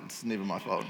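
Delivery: quiet mock repeat. Shown as a demonstration. Repeating the excuse softly to the church. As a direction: drop it low like a private excuse.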